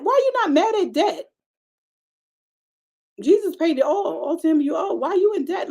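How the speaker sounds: high, worked-up challenge to the crowd; fired-up preaching rant. Retold or imitated. Preacher scolding the church, high and worked-up. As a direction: rail at them for not being mad at debt. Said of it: "I'll be like".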